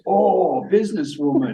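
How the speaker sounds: put-on high, amused French accent. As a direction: play the painter, impressed, in a French accent. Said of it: "And he goes".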